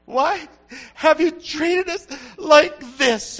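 a mother distraught at her lost son, tearful; shaken Mary. Acted. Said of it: "Mary was shook up".

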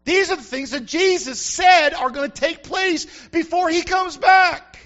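rising to a loud shout, slowing at the end; preaching shout. Real, not acted. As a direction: preach it hard, drag out the last words. Preacher warning of judgment.

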